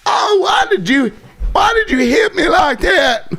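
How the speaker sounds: high, wailing fake sob of a woman; hammed-up mock crying. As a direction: overplay the victim, crying out. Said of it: "And she's".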